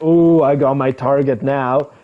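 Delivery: loud, slow, gloating predator; predatory scammer. Retold or imitated. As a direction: savor locking onto the prey. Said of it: "the scammer's like".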